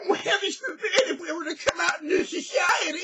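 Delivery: fast, high mock of a prissy voice; mocking prissy brother. Mocking his brother's prissy voice to his face. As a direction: parody his fussy, prim way of talking. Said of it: "Is that how I sound?".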